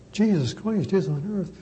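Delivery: ordinary delivery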